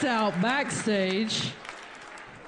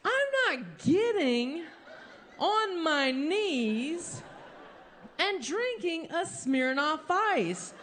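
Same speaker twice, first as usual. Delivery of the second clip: higher, slow, laughing refusal; indignant; 6 semitones higher, slower than usual. Retold or imitated. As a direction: drag it out in mock outrage. Refusing to kneel and chug.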